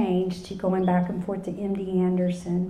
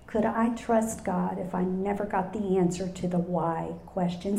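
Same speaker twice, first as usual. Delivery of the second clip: ordinary talk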